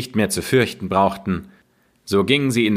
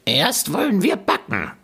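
much higher, sneering witch voice; mocking witch; 10 semitones higher than usual. Witch scoffing at the crying Gretel, high and sneering. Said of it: "sagte die Alte".

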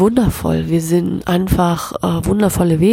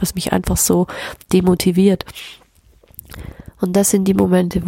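ordinary delivery

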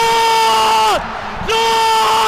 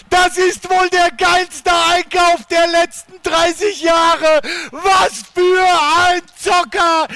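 screaming goal commentary; 3 dB louder than usual. Acted. Haaland goal radio call.

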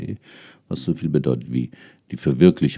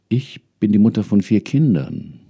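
pleading woman answering; 7 semitones higher, 7 dB louder than usual. Acted. Woman answers ancestors' voices.

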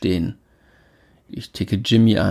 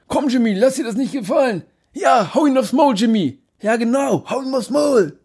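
high rowdy heckler voice; rowdy party animal; 14 semitones higher than usual. Acted. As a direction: egg on the fighters, rowdy. Crowd member egging on fight.

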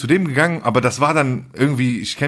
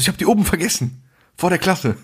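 high-pitched mock dismay; feigned dismay; 7 semitones higher than usual. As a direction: play up the fake forgetfulness. Acts out the boys' staged loss. (hoch, gespielt bestürzt)

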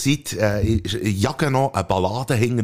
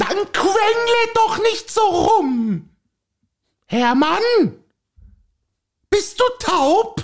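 a hammed-up exasperated wife, higher and sharper; mock irritated snap; 3 dB louder, slower than usual. Wife snapping at husband Hermann, mock irritated. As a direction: scold him as if at the end of your rope.

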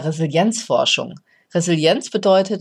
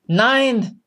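desperate man on the roof roaring his refusal; bellowed no; 6 semitones higher than usual. A narrator's reading. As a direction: bellow it back with the last of his strength. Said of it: "brüllte unser Mann mit letzter Kraft zurück".

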